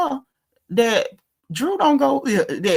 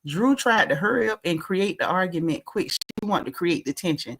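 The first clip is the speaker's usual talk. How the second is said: usual speaking voice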